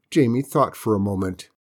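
usual speaking voice

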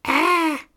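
shorter high-pitched "Ah!" scream; a brief shriek, high and somewhat loud. A narrator's reading. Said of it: "I screamed a little more".

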